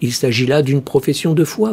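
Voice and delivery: ordinary delivery, nothing marked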